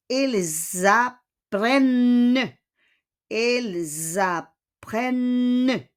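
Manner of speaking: very slow "Ils apprennent", il-zapprenne with held N; teacher's stretched model "Ils apprennent"; Z liaison. Shown as a demonstration. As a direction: draw out "Ils apprennent", S as Z, ent silent. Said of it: "gagawin natin ulit na Z".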